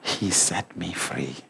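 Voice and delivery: very low, slow and soft; hushed testimony. Real, not acted. Preacher telling congregation why he stays sober, hushed testimony. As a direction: sink slowly almost to a murmur.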